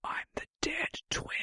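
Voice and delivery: very soft, ghostly whisper; creepy whisper. Acted. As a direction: whisper it like a dead twin. Creepy joke line, whispered.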